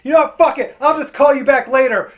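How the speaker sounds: loud, high, angry brush-off; fed up. Retold or imitated. Frustrated customer about to hang up on agent, angry. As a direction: end the call in exasperation. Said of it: "I got fed up".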